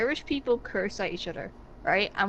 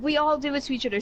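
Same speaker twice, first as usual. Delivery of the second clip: ordinary delivery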